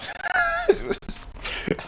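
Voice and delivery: high startled shriek of laughter; scream-laugh from the scare. Real, not acted. Startled by sudden noise.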